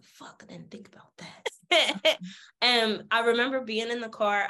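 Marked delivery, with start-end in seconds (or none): 0.0-1.5 breathless whisper, almost silent; whispered panic. Retold or imitated. Telling how she froze when dad mentioned casino cameras, whispered. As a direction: whisper it like you just got caught.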